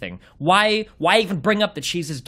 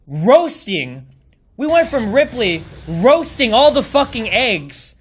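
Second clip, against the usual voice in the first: ordinary delivery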